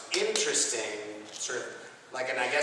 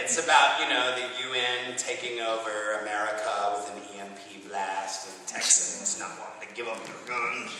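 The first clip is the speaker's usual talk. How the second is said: ordinary talk, nothing marked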